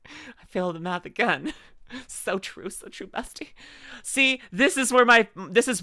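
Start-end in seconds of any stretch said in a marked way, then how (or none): 2.1-3.5 whispered aside. Pretend. Agreeing with chat teasingly.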